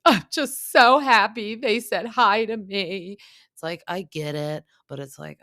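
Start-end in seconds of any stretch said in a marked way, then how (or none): 0.1-3.2 high, loud, slow sappy mimicry; mock him as a gushing sap; mocking Tom's gushing joy; mocking whiny voice. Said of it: "he's like".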